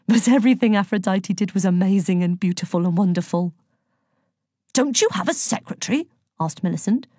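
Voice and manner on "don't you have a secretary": high, fussy official voice; affronted bureaucrat's question; exasperated inspector. A narrator's reading. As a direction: demand it in disbelief.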